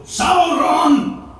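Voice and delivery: high loud wizard yell; shouting at Sauron. Acted. Gandalf taunting Sauron at the gates, loud yell. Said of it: "and go".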